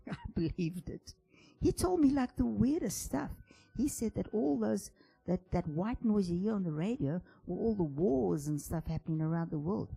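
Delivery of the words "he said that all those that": ordinary delivery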